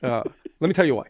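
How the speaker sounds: lightly lecturing Bernie voice; Bernie Sanders impression. Host repeating Bernie's catchphrase as a light imitation. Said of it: "Bernie's like".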